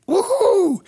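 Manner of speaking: very high cheer; excited whoop. Retold or imitated. Church people cheering.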